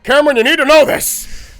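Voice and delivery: loud, high, aggressive dad; stern dad voice. Retold or imitated. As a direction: bark it like a pushy father. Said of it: "very direct about it and extremely awkward".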